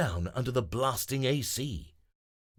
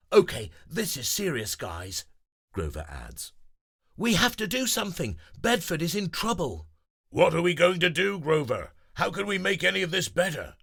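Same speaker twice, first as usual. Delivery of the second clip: higher, faster worried voices; urgent friends; 8 semitones higher, 4 dB louder than usual. Acted. Friends urging action, higher and fast. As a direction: press for a plan.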